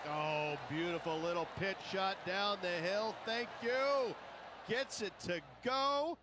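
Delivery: high, rising cheer into a shout; excited. Real, not acted. Co-commentator cheers the hole-out.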